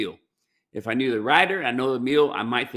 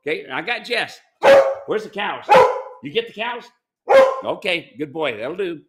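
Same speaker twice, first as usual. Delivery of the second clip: higher, sing-song call to a dog; 5 semitones higher, 9 dB louder than usual. Real, not acted. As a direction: coax the dog over in a raised voice. Calling his dog Jess over.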